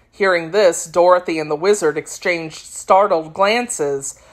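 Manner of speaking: plain reading voice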